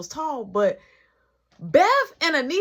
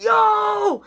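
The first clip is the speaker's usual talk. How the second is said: high, loud yell of Yo; shout; 10 semitones higher, 7 dB louder than usual. Retold or imitated. Mark shouting as the punch lands, a loud high yell. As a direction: call out sharply to stop the fight. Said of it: "Mark like".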